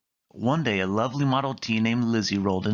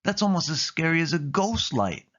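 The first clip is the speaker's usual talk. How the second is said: scared; 7 semitones higher than usual. A narrator's reading. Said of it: "with a shiver".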